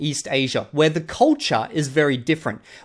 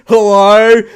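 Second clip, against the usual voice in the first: loud greeting; 4 semitones higher, 13 dB louder than usual. Retold or imitated.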